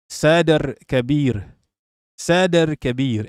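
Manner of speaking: slow "Sadr Kabir", rolled r, stress on "Sadr"; careful Arabic pronunciation. Shown as a demonstration. Streamer teaching chat the Arabic, slow careful pronunciation. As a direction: sound out "Sadr Kabir" syllable by syllable, slowly. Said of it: "it's not Sa, it's Sadr".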